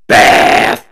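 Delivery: loud, drawn-out shout; mock battle cry. Co-host calling a winner, loud drawn-out shout. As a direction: belt out the team name. Shouted team name in prediction.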